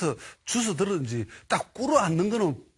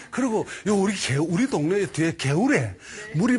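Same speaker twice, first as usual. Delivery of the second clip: ordinary talk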